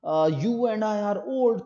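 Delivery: slow, stirring address to sailors; rhetorical recitation. Shown as a demonstration. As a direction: recite it like a leader rallying his crew. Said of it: "खिताबाना अंदाज में".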